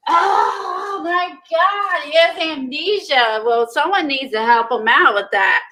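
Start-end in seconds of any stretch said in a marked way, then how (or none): 0.1-3.4 high, drawn-out gasping concern; real dismay and amazement. Real, not acted. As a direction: wail it high, as if it were dreadful news. Reacts to news about favorite character.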